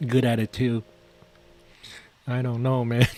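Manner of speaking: usual speaking voice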